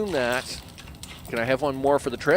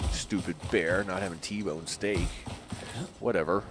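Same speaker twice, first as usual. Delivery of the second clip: softer, lower grumbling; muttered grumble; 7 semitones lower, 9 dB quieter than usual. Real, not acted. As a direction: grumble it under your breath. Muttering sulk.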